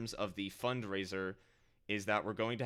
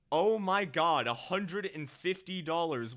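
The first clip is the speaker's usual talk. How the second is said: amazed; 6 semitones higher, 5 dB louder than usual. Real, not acted. Total donation sinking in.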